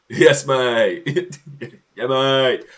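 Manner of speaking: loud, hammed-up English yob greeting. Streamer mimicking English lads' greetings, mock yobbish. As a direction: play a laddish English yob.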